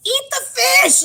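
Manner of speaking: high, loud screamed command; imitated scream. Retelling an annoyed Maureen screaming at her over dinner, imitated scream. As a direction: yell it as an exasperated outburst. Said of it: "she just screams at her".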